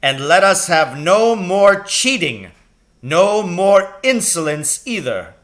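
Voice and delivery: loud, slow, high stern teacher; dreaded voice. A narrator's reading. Dreaded teacher commands the class back to work, loud. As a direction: boom it at the class. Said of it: "the dreaded voice said".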